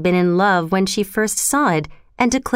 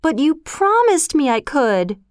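put-on higher, upset girlish protest; crying out; 7 semitones higher than usual. A narrator's reading. Anne reminds Marilla of her promise, crying out in dismay. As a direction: protest in dismay, without shouting. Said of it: "Anne cried".

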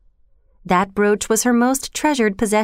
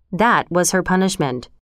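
ordinary delivery

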